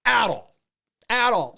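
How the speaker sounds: "at all" punched high and loud; emphatic repetition. Real, not acted. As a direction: hit both "at all" hard, each set apart. Stressing how badly he reacted.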